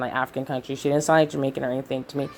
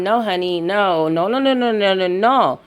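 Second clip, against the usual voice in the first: emphatic refusal; 3 semitones higher than usual. Real, not acted.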